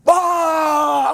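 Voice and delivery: loud, high imitated crowd roar; sudden shouted crowd cheer. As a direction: belt out a burst of crowd noise. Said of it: "They still like".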